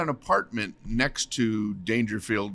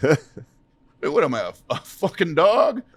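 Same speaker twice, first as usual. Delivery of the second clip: Rodney Dangerfield impression; 5 semitones higher, 6 dB louder, slower than usual. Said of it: "Rodney goes".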